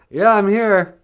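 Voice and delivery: high, loud call across a distance; calling back. Pretend. Traveller answering sweetie from Sesame Street, calling loudly. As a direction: holler back that you arrived. Calls back from far away.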